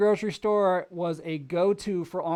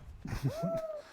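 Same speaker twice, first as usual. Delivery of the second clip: very high short squeal; high giggle burst; high squeal laugh; 5 dB quieter than usual. Real, not acted. Laughing at game mishap.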